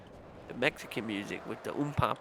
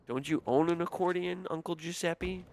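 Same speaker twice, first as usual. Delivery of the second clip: higher-pitched incredulous question; mock questioning; 6 semitones higher than usual. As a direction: needle a relative with a pointed question. Mock challenge to hypocritical uncle.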